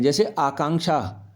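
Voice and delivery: ordinary talk, nothing marked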